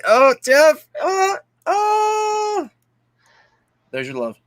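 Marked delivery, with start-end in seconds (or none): 0.1-2.7 excited squeal. Real, not acted. Squealing greeting.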